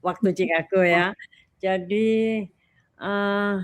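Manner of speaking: ordinary talk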